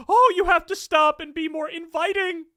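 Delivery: high, mocking singsong of the scolds; mocking whine. Host mocking critics of Democrats in a high mock voice. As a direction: sneer it in a prissy high voice.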